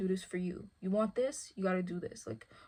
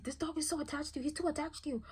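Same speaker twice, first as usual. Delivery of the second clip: mock other people voice; 8 semitones higher, 4 dB quieter, faster than usual. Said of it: "And they're like".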